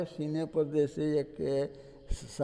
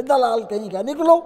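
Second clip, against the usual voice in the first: furious loud roar, much higher on निकलो; roaring anger; 8 semitones higher, 11 dB louder than usual. Retold or imitated. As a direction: throw the man out with a roar. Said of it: "बाबा फिर जोर से दहाड़ उठे". (गुस्से में ज़ोर से दहाड़ते हुए)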